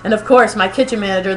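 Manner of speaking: ordinary talk, nothing marked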